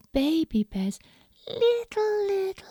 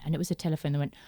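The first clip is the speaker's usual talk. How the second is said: ordinary delivery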